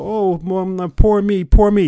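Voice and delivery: high, loud self-pitying whine in mockery; mock a sulker crying to mom; mock sulking. Coach imitating a listener wallowing in self-pity, mock sulking. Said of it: "sulk and go".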